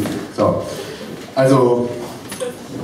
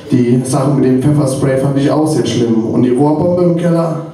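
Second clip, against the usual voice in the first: put-on pleading, higher-pitched boyfriend appeal in verse; slam poem recital; 5 semitones higher than usual. Slam poet pleading with his ex-girlfriend, poem recital. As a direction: recite it as an aggrieved lover's appeal.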